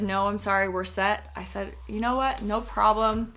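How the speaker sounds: ordinary talk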